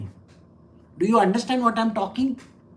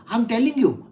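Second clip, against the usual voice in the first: ordinary delivery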